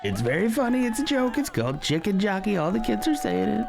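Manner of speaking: very high-pitched, squeaky put-on voice; silly meme voice. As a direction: explain the joke in a goofy high voice. Clowning about the meme.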